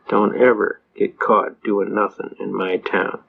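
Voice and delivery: lage trage dreigende rechter; de rechter waarschuwt de jonge advocaat, laag en traag; menacing judge. Retold or imitated. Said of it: "He said".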